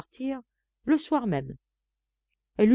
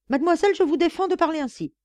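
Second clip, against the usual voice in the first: indignant nun; 10 semitones higher, 5 dB louder, faster than usual. Acted. Nun forbids the maid.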